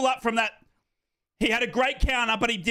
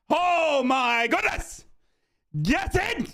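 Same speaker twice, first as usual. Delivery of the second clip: excited; 5 semitones higher, much slower than usual. Real, not acted. Big chance.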